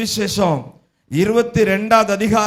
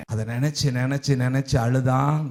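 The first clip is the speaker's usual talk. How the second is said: much softer and lower, sinking; hushed and low; 9 semitones lower, 6 dB quieter than usual. Acted. Preacher tells congregation Peter wept, hushed and low. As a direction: let the voice drop to a hush.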